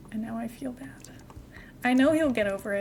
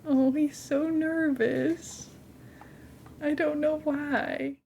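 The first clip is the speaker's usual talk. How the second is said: slow, drawn-out, higher mock-tragic sigh; slow, higher hammed-up sorrow over the cat; mock dramatic lament; 4 semitones higher, slower than usual. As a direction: overplay the worry like a soap scene. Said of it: "Sorry, wrong scene".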